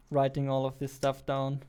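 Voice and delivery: ordinary talk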